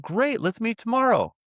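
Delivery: high mock voice, suddenly friendly; friendly landlord. Landlord apologizing to the caller about the noisy poker game, high mock voice. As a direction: pitch way up, chatty and apologetic.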